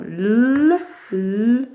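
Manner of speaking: L held long as "lll", twice; isolated L sound. Shown as a demonstration. Teacher showing pupils the sound to find, long held L. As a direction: hum the bare consonant L, then repeat it. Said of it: "on cherche le son L".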